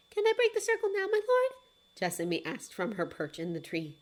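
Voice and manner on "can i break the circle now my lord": high, eager little bird voice; much higher, bright bird cry; raven's voice. Acted. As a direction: squawk out the greeting like a small raven. Raven asks her master.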